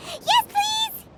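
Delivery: high, thrilled yes please; eager excitement. Real, not acted. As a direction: leap at the idea with delight. Paimon eagerly greets festival idea.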